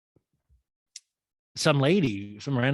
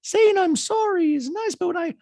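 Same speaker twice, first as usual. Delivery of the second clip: very high mocking impression, starting loud; shrieky falsetto, stretched out; hammed-up high-pitched whine; Dorit; 5 dB louder than usual. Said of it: "And Dorit goes".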